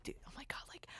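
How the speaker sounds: breathless whispered fan gush; starstruck whisper. Retold or imitated. Fan meeting her idol, imagined whispered freak-out. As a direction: hush it like you can't believe who's in front of you. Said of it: "I wasn't like".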